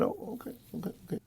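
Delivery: whispered oh okay, taken aback; hushed, caught off guard; stunned whisper. Retold or imitated. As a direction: breathe it out, floored. Stunned by Bill's answer.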